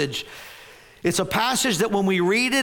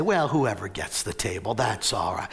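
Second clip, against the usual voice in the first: sarcastic mock calm; 10 semitones lower, 4 dB quieter than usual. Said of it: "I'm being a little sarcastic".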